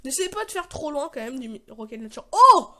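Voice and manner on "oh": loud, high gasp of "oh"; sudden loud cry of surprise; astonished. Real, not acted. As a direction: burst out in amazement at the blast. Big explosion hits before him.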